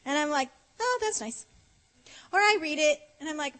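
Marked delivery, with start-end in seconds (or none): 0.8-1.4 high-pitched polite brush-off; mock-polite, higher voice; lukewarm politeness. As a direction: acknowledge it sweetly without believing it. Lukewarm reaction to love letter.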